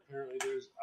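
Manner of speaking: ordinary talk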